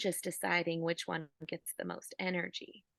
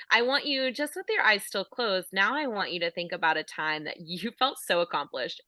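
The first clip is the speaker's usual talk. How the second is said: louder, rousing coach voice; energised change of voice; 5 dB louder than usual. Shown as a demonstration. Coach recalling a time you felt so accomplished, energised and louder. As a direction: pump them up with their own accomplishments. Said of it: "could probably hear the change in my voice".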